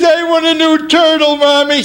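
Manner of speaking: put-on high-pitched little boy pleading; spoiled brat. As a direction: demand it from mom in a child's voice. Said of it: "I was a spoiled brat".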